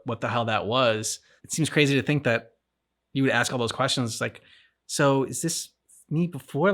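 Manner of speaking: ordinary talk, nothing marked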